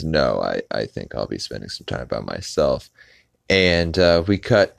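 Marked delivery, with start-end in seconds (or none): 0.0-2.9 low, soft, understated imitation; Brian. Brian declining to go on the trip, low quiet imitation. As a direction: drop into a quiet, low cartoon dog voice. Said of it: "he says".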